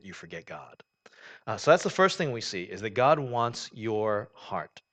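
ordinary talk, nothing marked

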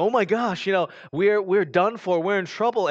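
high, loud mock panic; panicked Philistines. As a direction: cry out like frightened enemies. Terrified Philistines voiced.

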